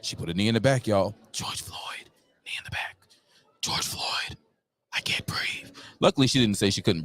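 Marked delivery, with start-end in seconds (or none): 1.3-3.0 breathy whispered aside. Acted. Host whispering to viewers about the knee. 3.6-5.7 whisper. Acted. Whispering aside.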